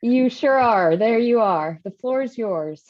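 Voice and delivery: ordinary talk, nothing marked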